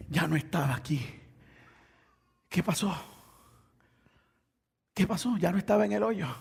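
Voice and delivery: soft, suspenseful storytelling; quiet, puzzled preacher's re-enactment; hushed bewilderment. Acted. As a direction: drop the volume and wonder where he went. Hushed telling of Reuben's discovery.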